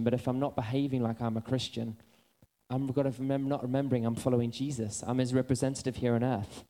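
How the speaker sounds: ordinary talk, nothing marked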